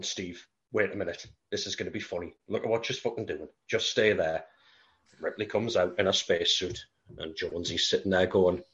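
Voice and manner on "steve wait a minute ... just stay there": low and much softer, a conspiratorial hush; hushed cat scheming. Retold or imitated. Cat hushing the alien while Ripley suits up, conspiratorial hush. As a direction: keep it down like you're plotting a prank.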